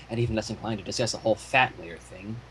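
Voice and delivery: quick and quiet, hurried mumble; rushed. Real, not acted. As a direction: hurry through it under your breath. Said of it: "Can you slow that down?".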